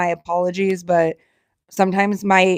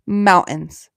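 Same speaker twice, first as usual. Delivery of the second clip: ordinary talk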